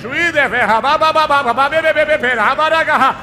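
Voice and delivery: loud, laughing tongues with chanted syllables; ecstatic tongues. Real, not acted. Pastor in ecstatic tongues during deliverance, laughing. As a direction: let the tongues tumble out in joyful bursts.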